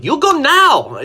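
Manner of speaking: very high, loud, stretched-out dismissal; mock outraged order. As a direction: imitate a shrieked order, dragging each word. Officer's retold order to leave.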